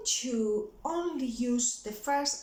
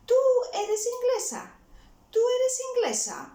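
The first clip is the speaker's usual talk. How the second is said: "Tú eres inglesa" held high and loud as a question, dropping at the end; 13 semitones higher, 5 dB louder than usual. Shown as a demonstration. Tutor showing learners how a question mark is read, question tone. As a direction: ask "Tú eres inglesa" in a much raised voice. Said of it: "make it sound like a question".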